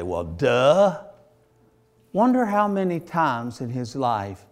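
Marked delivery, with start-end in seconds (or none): 0.0-1.0 mock obviousness. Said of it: "that you'd say".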